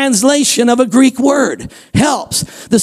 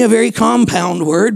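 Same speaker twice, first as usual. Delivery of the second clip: usual speaking voice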